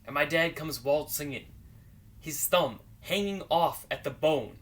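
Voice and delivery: usual speaking voice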